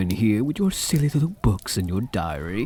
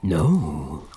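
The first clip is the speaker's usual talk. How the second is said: normal talking voice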